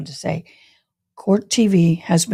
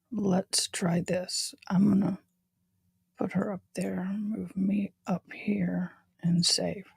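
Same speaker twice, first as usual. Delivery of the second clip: soft unvoiced whisper; 13 dB quieter than usual. Real, not acted. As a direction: whisper it to yourself. Whispering while adjusting.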